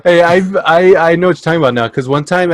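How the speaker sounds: ordinary delivery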